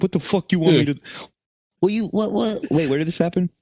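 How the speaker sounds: a put-upon cop who can't be bothered; grumpy cop brushing off a report. Retold or imitated. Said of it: "That sounds like a cop".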